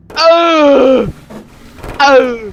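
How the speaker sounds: loud, high mock wails; hammed-up cries on being hit; acted pained howl. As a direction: howl out, sliding down in pitch. Character hit by thrown pizza.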